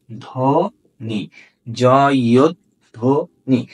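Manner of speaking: slow spelling of জয়ধ্বনি for the class; slow syllable spelling. Shown as a demonstration. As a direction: sound out জয়ধ্বনি as জ, ইয়দ, ধ্বনি slowly. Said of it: "তাহলে আমরা লিখবো কি?".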